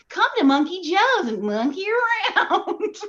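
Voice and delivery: high, peppy radio spot; playful commercial delivery, soaring at the end; everyperson ad read. Acted. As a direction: sell it like a kids' play-place commercial. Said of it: "just me being like".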